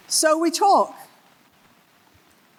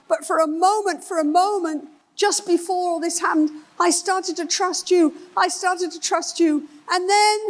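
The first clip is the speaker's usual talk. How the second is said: higher, desperate pleading voice; pleading husband; 8 semitones higher than usual. Retold or imitated. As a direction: beg her to prove her loyalty. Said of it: "He's saying".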